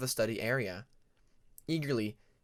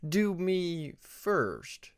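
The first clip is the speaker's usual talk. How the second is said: distracted drawl; 4 semitones higher, much slower than usual. A narrator's reading. Grumbled math answer.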